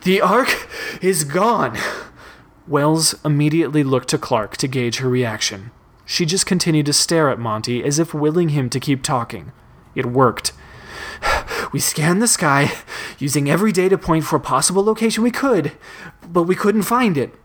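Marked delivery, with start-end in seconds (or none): 0.0-1.8 slow, higher, winded announcement; stunned and short of breath; breathless dismay. A narrator's reading. Winded boy reports dire news. 11.7-17.4 higher, urgent, winded report; puffed and anxious; breathless explanation. A narrator's reading. Winded boy explains, distressed.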